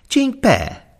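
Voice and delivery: high, quick obedient reply; head steward. A narrator's reading. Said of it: "mondta a főudvarmester".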